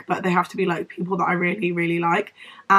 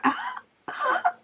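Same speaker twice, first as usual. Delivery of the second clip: voice cracking squeak; 6 dB quieter than usual. Retold or imitated. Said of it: "I keep going like".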